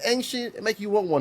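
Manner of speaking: high, teasing, playful. Retold or imitated. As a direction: tease him in a high voice. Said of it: "I'm like".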